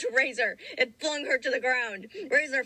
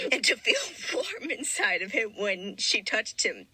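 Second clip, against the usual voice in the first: ordinary talk, nothing marked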